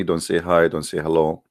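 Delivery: usual speaking voice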